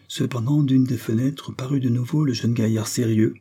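plain reading voice

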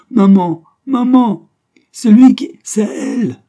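put-on very high, loud child voices; squabbling children calling. A narrator's reading. Children run to mother complaining, calling out shrilly. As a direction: shriek like tattling little children.

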